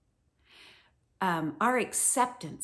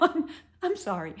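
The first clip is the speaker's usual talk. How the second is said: comic apology; 9 semitones higher, 4 dB louder than usual. Retold or imitated. Parent apologizing to kids.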